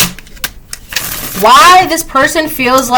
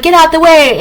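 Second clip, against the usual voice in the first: mock shout; 6 semitones higher than usual. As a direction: shout them out of your path, half-playful. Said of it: "you could be shouting".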